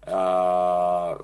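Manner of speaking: ordinary delivery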